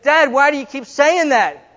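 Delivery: put-on frustrated child asking his dad; exasperated kid. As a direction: plead like a fed-up little boy. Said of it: "It's like".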